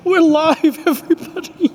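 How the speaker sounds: squeaky high breathless laughing; laughing too hard to speak. Real, not acted. Host cracks up on live air.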